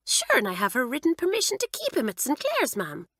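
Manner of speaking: high, cheery Irish lilt; blithely Irish, quite unbothered; Irish accent. A narrator's reading. Kitty cheerfully informing Mamselle, in Irish. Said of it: "an accent exactly like the one Doris had adopted".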